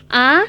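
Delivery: "a" swept upward like a question; rising tone. Shown as a demonstration. Teacher showing how Mandarin speakers react with "a", rising tone. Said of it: "Itu nada naik".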